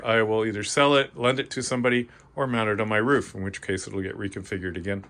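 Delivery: ordinary talk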